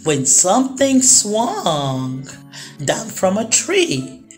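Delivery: ordinary delivery, nothing marked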